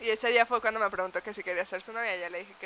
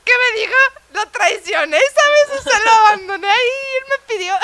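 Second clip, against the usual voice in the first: high, loud imitation of a sobbing man; hammed-up crying, wailing with guilt; mock tearful wailing; 11 semitones higher, 12 dB louder than usual. Boyfriend guilty over leaving Sam at daycare, mock sobbing. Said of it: "Estaba llorando".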